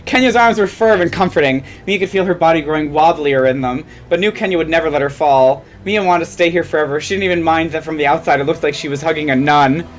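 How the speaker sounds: ordinary delivery, nothing marked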